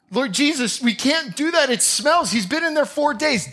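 a flustered mourner pushing back; alarmed protest. Acted. Martha protesting to Jesus, high and alarmed.

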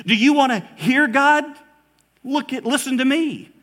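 booming sermon call; preaching at full force. Retold or imitated. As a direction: lift it up like a pulpit cry. Repeated call, loudest.

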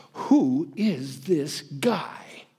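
slow, hushed whisper; wondering whisper. Retold or imitated. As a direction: breathe it out slowly in awe. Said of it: "the people were asking".

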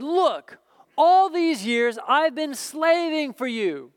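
hurt, accusing son; resentful older son. Acted. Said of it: "the older brother became angry".

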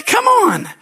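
high, loud comic protest; hammed-up exasperated yelp; exasperated appeal. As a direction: cry out in mock disbelief. Acted exasperation to congregation.

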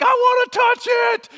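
high, loud, slow excited shout; eager shout. Retold or imitated. Rider shouting to a friend in the cave, eager. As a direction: shout it like a kid grabbing at something. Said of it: "and I'm like".

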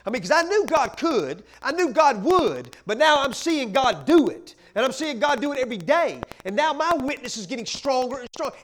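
impassioned sermon climax; full-force preaching. Real, not acted. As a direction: preach it out with fire.

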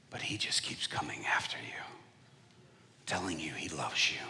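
low, soft whisper. Retold or imitated. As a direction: whisper it tenderly. Whispered retold plea.

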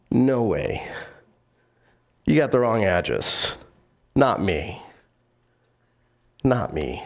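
low, spaced-out refusals; understated cocky denial, long pauses; acted firm denial.

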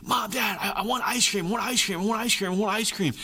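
a child nagging for ice cream, lightly played; whining child. Child nagging parents for ice cream, mild whine. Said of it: "like a child who's just kinda nipping at you".

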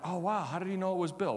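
quick, hushed mock wonder; mock amazement. Preacher mimicking a stunned caller, quick mock surprise. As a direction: play the baffled listener, fast. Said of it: "You're like".

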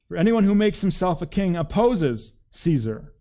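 high, loud crowd protest; shouted. A narrator's reading. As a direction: shout a warning at the governor.

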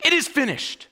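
high, celebratory declaration; triumphant. Acted. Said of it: "it's triumphant".

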